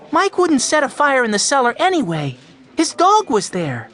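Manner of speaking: high-pitched, emphatic defence; insistent young boy. A narrator's reading. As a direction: argue eagerly for a friend. Boy defends friend to man.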